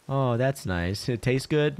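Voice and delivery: mock domestic cheer; mock spouse voice. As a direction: play the sweet welcoming partner. Mock spouse chat continues.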